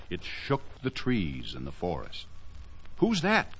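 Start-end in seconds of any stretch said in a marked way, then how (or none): 3.0-3.5 put-on high, small child's voice; alarmed little boy; startled boy's cry. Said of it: "cried Laughing Boy".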